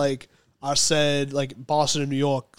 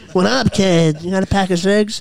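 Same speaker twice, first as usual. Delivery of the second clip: Boston tough guy; 4 semitones higher, 8 dB louder than usual. Retold or imitated. Imitating a Boston street guy.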